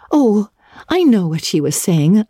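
ordinary delivery, nothing marked

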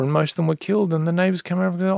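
ordinary talk, nothing marked

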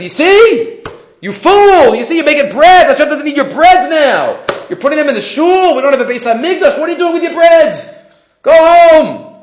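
high, loud acted tirade; imitated outraged rabbi yelling; angry berating. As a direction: scold him like a fool, building to a shouted dismissal. Rabbi berates the simple man.

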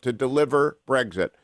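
usual speaking voice

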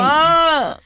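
high wail for papa; crying truck voice. Pretend. Truck calls the owner crying. (voz llorona de troquita)

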